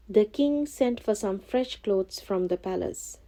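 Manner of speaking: ordinary delivery, nothing marked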